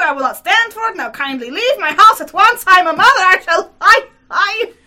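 high, loud, furious tirade; frenzied cry. A narrator's reading. Outraged lady ordering the visitor from her house, frenzied. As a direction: rage at him in a frenzy, near shrieking. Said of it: "she cried in frenzy".

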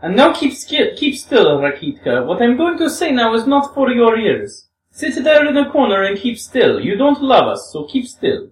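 a hint of a young woman, earnest and pleading; lightly voiced Grushenka. Grushenka voice again.

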